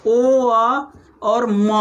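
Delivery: ओ, अ और म broken into separate sounds, very slow; ओ, अ और म stretched apart for students; spelling out sounds. Shown as a demonstration. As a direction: say ओ, अ और म one by one, slowly drawn. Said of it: "जैसे अगर मैं बोलूं ओम".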